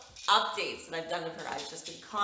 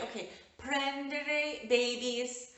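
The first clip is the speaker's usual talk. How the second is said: put-on higher, slow little girl voice; toddler voice; 8 semitones higher, slower than usual. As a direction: mimic the toddler mixing two languages. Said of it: "she'll be like".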